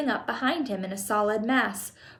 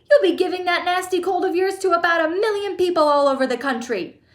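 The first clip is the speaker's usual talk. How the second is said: high, loud scolding; shouted; 8 semitones higher, 5 dB louder than usual. A narrator's reading. Mother scolding son about his cold, shouting. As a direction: scold at a high pitch, easing off at the end. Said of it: "shouted Missus Gloop".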